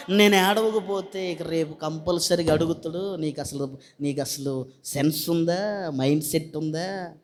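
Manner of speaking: low, soft grumbling lament; mock crying. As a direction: imitate someone weeping only out of duty. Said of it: "నేను అంటానేమో అని ఏడిస్తే". (మొక్కుబడి ఏడుపును అనుకరిస్తూ)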